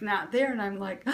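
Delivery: usual speaking voice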